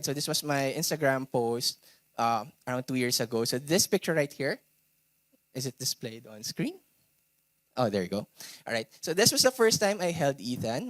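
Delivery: ordinary talk, nothing marked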